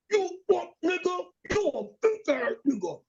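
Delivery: high, sneering imitated cursing; mock insult. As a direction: flip to nasty right after the sweetness. Wife's insult retold.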